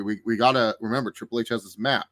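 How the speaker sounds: ordinary delivery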